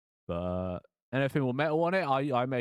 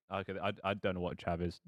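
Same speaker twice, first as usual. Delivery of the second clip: ordinary delivery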